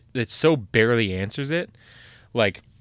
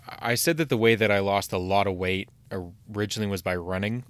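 normal talking voice

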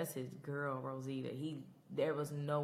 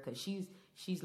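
ordinary talk, nothing marked

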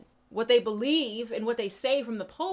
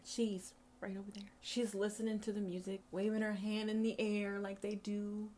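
soft, half-whispered wonder; hushed awe; 6 dB quieter than usual. Real, not acted. Preacher showing listener the deity nearby, hushed. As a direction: point her out in a hush.

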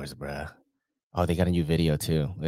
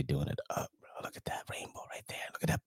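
whispering; 7 semitones lower, 14 dB quieter, faster than usual. Real, not acted. Admiring photos, hushed.

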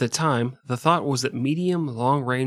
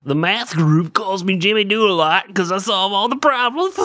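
nerd voice; 6 semitones higher, 5 dB louder than usual. Acted. Playing nerd Jimmy Doolittle.

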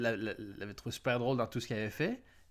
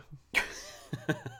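breathy wheeze of laughter; wheezing laugh; 4 semitones higher than usual. Real, not acted. Still laughing.

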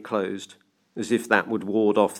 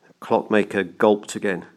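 ordinary delivery

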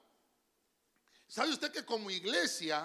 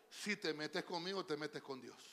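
softer, lower aside to the neighbour; say to your neighbour; 6 semitones lower, 9 dB quieter than usual. Shown as a demonstration. Preacher telling the church to tell their neighbour, softly. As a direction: murmur it to the person beside you. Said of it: "¿Qué le dijo el hermano?".

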